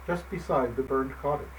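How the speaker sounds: ordinary delivery, nothing marked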